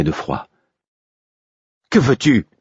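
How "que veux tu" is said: higher, louder furious demand; Molly demanding furiously from the window. A narrator's reading. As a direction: bite the question off in anger. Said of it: "siffla-t-elle, furieuse".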